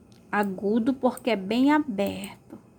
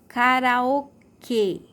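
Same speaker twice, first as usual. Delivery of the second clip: "karaokê" very slow, detached strong KÊ; "karaokê" drawn out, closed ê stressed at the end; stressed final syllable; 3 semitones higher, 4 dB louder, much slower than usual. Shown as a demonstration. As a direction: separate and lean on KÊ in "karaokê". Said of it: "a sílaba mais forte".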